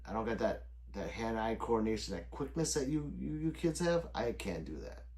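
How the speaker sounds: normal talking voice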